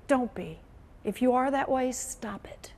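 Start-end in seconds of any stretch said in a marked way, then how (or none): 1.9-2.7 hushed, slow, breathless urging; whispered plea. Real, not acted. Veteran anchor urging young reporters, in a whisper. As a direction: drop to a whisper to plead.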